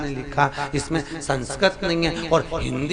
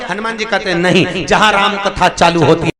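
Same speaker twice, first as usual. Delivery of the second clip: higher, louder rolling sermon; full-force preaching; 6 semitones higher, 11 dB louder than usual. Acted. Preacher rouses the crowd, higher and louder. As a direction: preach it out to the hall.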